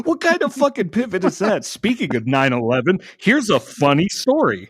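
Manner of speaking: loud, high disbelief; appalled and amused, voice jumping up loud; incredulous outburst. Real, not acted. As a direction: throw the lead-in back in mocking astonishment. Incredulous outburst, mockingly quoting the other's lead-in.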